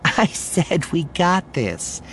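slow, high, firm insistence; insistent reassurance. A narrator's reading. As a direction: cut off the fretting, stretching each word. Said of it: "gives Aaliyah's arm a squeeze".

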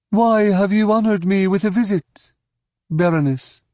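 high, put-on alien voice; officious scientist receiving a noblewoman; stern alien scientist. A narrator's reading. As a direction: question a visitor in a high, formal voice.

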